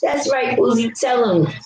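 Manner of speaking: mocking fans. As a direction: ham up the gushing commenters. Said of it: "and they".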